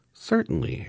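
very high, tiny squeak; squeaky mouse voice. A narrator's reading. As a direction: squeak like a little mouse queen. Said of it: "in her squeaky little voice".

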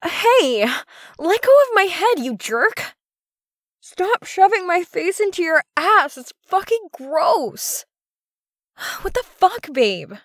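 high-pitched, flustered objection; indignant protest. Acted. Woman objects to her partner, indignant and high.

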